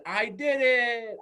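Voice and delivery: loud, high, long drawn-out cheer; leaping triumph. Retold or imitated. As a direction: stretch it out like a kid jumping for joy. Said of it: "jumped in midair and said".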